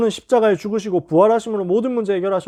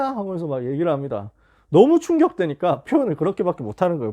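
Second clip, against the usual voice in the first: normal talking voice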